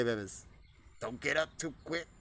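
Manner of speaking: normal talking voice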